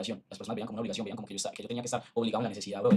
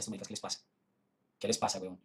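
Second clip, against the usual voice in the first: much softer, exasperated under the breath; muttered to himself; 4 semitones lower, 9 dB quieter than usual. Retold or imitated. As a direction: mutter it like you can't believe them. Retelling his reaction to comments. (murmullo exasperado, mucho más suave)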